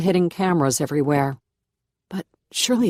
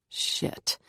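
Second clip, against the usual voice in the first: breathy whispered curse to herself; 9 dB quieter than usual. A narrator's reading. Narrator realizes her scream woke the house, whispered. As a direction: whisper it under your breath, caught out.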